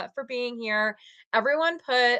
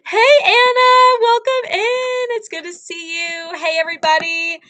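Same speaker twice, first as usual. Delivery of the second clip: excited greeting; 10 semitones higher, 8 dB louder than usual. Real, not acted. Greeting a viewer joining live.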